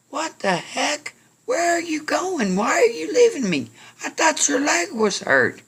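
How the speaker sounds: very high, loud yelling after someone; acted outraged shouting. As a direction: shriek it in baffled outrage. Abandoned carrier shouts after runner.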